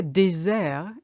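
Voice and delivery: désert with a Z sound, "day-ZAIR"; French désert. Shown as a demonstration. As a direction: say désert the French way, buzzed S and silent T. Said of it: "you shouldn't say dessert".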